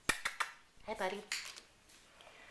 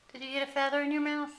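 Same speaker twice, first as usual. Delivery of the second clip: higher, louder and fast, delighted; excited puppy talk; 6 semitones higher, 8 dB louder, much faster than usual. Real, not acted. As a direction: gush at the pup with quick glee.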